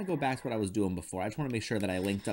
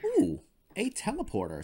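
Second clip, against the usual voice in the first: excited; 13 semitones higher than usual. Real, not acted. Finds teleporter in game.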